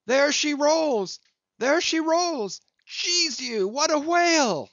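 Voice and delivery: high, loud sailor's cry of sighting; a lookout yelling he has spotted the whale; excited shout. Acted. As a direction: bellow it from the chains at first sight of the whale. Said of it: "all at once shouted out".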